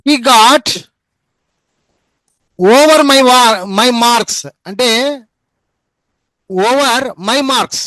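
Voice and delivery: over my marks, very slow and deliberate; slow dictation. Shown as a demonstration. Teacher dictating an example phrase slowly to students. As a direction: spell it out word by word for the class. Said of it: "అంటే దీని అర్థము".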